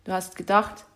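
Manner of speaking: ordinary delivery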